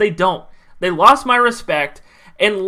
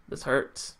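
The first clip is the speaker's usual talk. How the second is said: whispered, much softer, voice dropping away; heartbroken whisper; 9 semitones lower, 14 dB quieter than usual. Real, not acted. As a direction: barely breathe it out, hurt. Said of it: "I'm emotional now".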